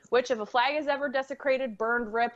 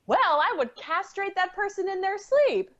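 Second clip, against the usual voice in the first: higher acted voice of an indignant mother; Chi-Chi; 7 semitones higher than usual. A mother answering about her son's kidnapper, high-voiced. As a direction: answer in a high, put-on character voice. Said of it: "so, Chi-Chi, how would you react".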